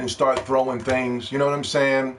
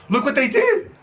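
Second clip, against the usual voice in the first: worked-up rant; 9 semitones higher than usual. Real, not acted. Fan venting at Sony for funding the director, high exasperated rant. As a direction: rant it out, disbelieving. Ranting about Sony's bad deal.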